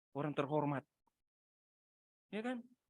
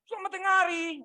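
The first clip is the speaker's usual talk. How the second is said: put-on hungry kid's call; 6 dB louder than usual. Hungry child calling out greeting.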